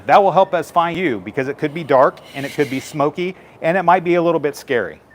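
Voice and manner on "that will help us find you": ordinary talk, nothing marked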